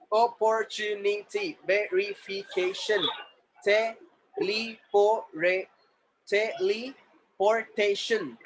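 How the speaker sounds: very slow, separated syllables. Shown as a demonstration. Teacher modelling syllables for the class, syllable by syllable. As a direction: break the word into slow beats.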